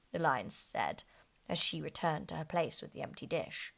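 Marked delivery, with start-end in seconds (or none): none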